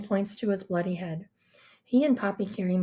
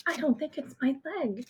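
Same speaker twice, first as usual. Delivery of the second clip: ordinary delivery, nothing marked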